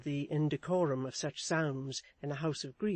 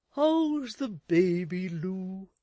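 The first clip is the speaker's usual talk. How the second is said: raised, fussy husband voice; Mister Chick's voice; 4 semitones higher, 7 dB louder, much slower than usual. A narrator's reading. Husband fussing at his wife, in a raised fussy voice. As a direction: fuss over his wife anxiously. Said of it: "to change the subject".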